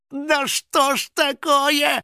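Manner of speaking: high, stretched-out cry of dismay; Финик panicking. A narrator's reading. Финик stuck as the hunter closes in, panicking. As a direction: wail it in helpless panic. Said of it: "запаниковал Финик".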